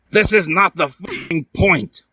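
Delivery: high, sharp snap; snapping. Acted. Edward cutting off Hiei about Sasuke, with a high sharp snap. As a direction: cut them off hard. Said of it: "barged Edward".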